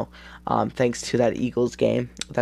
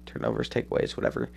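whispered aside; 13 semitones lower, 8 dB quieter, faster than usual. Real, not acted. Muttered self-correction aside.

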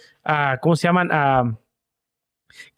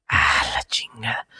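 low breathy whisper of annoyance; frustrated whisper; 7 semitones lower, 4 dB quieter, slower than usual. Retold or imitated. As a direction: sigh it out under your breath. Marido frustrado en el chiste. (susurro frustrado del marido)

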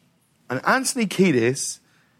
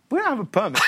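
laughing; 8 semitones higher, faster than usual. Retold or imitated. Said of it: "We were like".

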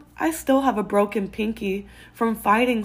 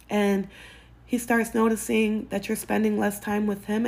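usual speaking voice

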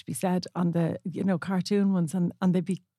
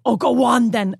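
louder, exasperated mock surrender; dad giving in; 4 semitones higher, 5 dB louder than usual. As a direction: cave in like a worn-down dad. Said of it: "he'd go".

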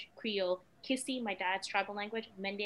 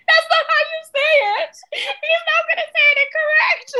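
tearful protest; 17 dB louder than usual. Retold or imitated. Upset mother protesting through tears.

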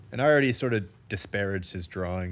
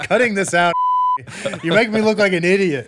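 mock outrage; 9 semitones higher, 7 dB louder than usual. Mock outrage, cutting podcast bit.

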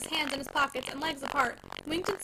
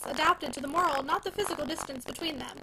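ordinary delivery, nothing marked

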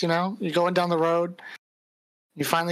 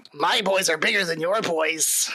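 menacing goblin leader, pitched up; taunting goblin bandit; 7 semitones higher than usual. Acted. Game master voices goblin ambusher.

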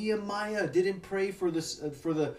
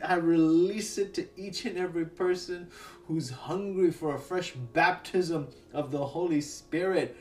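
ordinary delivery

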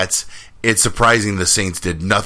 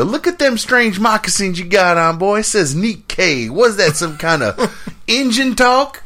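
high, slow mocking cowboy drawl; old western barkeep; 13 semitones higher than usual. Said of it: "And they go".

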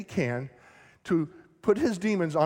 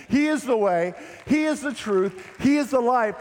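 rising proclamation; 6 semitones higher, 4 dB louder than usual. Real, not acted. Preacher's climactic declaration.

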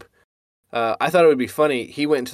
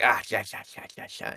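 soft, fading lisping voice; lisping friend; 3 semitones lower, 9 dB quieter, faster than usual. Retold or imitated. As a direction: imitate a shy lisping friend. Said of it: "he was like".

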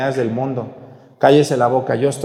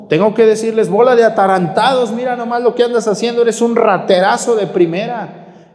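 harsh scolding; 6 semitones higher than usual. Acted. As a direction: scold them hard to wake them up. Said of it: "nos habla muy duro".

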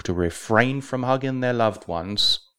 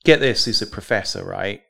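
normal talking voice

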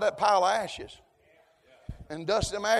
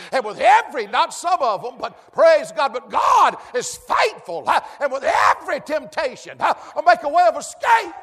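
loud, higher shouted preaching; preaching at full force; 5 semitones higher, 10 dB louder than usual. Real, not acted. Preacher shouting scripture to the congregation at full force. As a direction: whoop it out to the church.

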